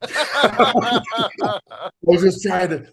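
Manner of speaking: talking through a burst of laughter; laughing. Real, not acted. Laughing after teammate's joke.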